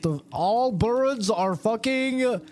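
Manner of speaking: high, slow ranting crackpot; conspiracy nut. Retold or imitated. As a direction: go high and drawn out like a crank. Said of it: "a guy being like".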